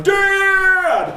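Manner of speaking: high, loud call of Dad; son calling. Retold or imitated. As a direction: yell for Dad from the doorway. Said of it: "Gabriel walks through the door going".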